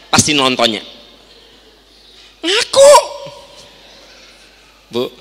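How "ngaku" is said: mock demand. Mock interrogating child.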